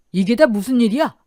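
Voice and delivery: loud, urgent demand; alarmed exclamation. Acted. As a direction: burst in demanding an explanation. Father-in-law bursts into emergency room.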